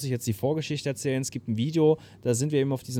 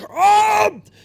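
sehr hoch und sehr laut geschrien; leidenschaftlicher Schrei; 12 dB louder than usual. Retold or imitated. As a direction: leg die ganze Kraft des Körpers in den Ruf. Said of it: "mit so einer Kraft und so einer Leidenschaft". (Sängerin schreit ihr Publikum voller Leidenschaft an)

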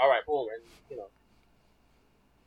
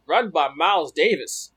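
announcer voice; 2 semitones higher, 7 dB louder, slower than usual. Retold or imitated. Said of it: "he was like".

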